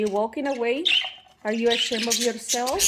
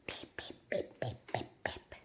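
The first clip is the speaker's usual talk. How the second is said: peep; 13 semitones lower, 16 dB quieter than usual. Retold or imitated. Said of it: "they were like".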